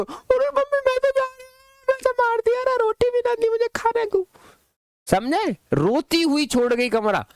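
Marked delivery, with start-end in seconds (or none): 0.0-4.3 put-on high, far squeaky voice of a weepy girl; mock crying girl. Teacher acting a girl crying to her mother. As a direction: whine it like a daughter sobbing to mummy. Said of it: "रोती हुई ऐसे करके".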